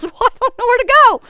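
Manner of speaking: high, loud, fast protest from the husband; flustered husband. Retold or imitated. Husband protesting to the angry driver, high, loud and fast. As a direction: blurt it out, high and loud, cornered in traffic.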